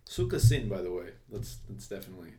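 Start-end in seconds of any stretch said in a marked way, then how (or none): 0.1-0.7 "Suka sin" said loud and punchy in Russian; Russian swear word. Shown as a demonstration. Teaching a Russian curse, punchy Russian pronunciation. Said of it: "it means the B word in Russian".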